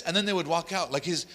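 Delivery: usual speaking voice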